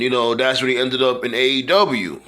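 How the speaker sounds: ordinary talk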